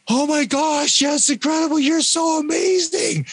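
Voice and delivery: high-pitched, drawn-out gushing; gushing friends. Retold or imitated. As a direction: imitate friends squealing in over-the-top admiration. Voices friends gushing over her.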